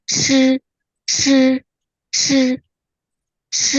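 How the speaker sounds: "ch" with only the tongue tip curled to the palate; loud, high, level "ch" demo. Shown as a demonstration. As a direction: curl the tip up for each "ch". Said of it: "digulung ujung lidahnya aja".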